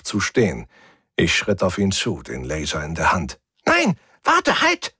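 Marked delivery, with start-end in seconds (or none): 3.6-4.9 very high, frantic little alien; panicked alien voice. Acted. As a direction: cry out high like a caught stowaway. Cornered alien begs.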